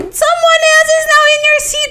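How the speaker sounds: very high, indignant retold screech; screaming. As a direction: shriek your complaint at your friend. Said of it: "I started screaming at her".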